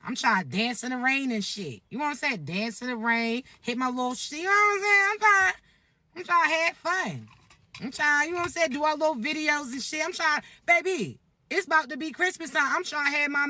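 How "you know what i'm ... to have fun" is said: excited outburst. Real, not acted. Excited about having fun.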